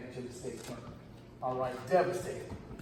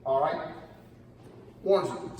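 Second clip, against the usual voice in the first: ordinary talk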